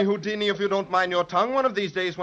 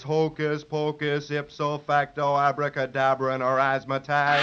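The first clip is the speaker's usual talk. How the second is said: slow, lower spell-casting chant; magic incantation; 4 semitones lower, slower than usual. Acted. Magician casting a spell over his hat, slow incantation. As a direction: intone the magic words grandly.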